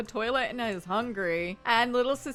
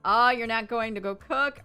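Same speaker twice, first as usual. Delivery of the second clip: normal talking voice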